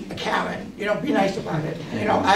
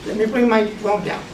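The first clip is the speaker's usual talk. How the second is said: ordinary talk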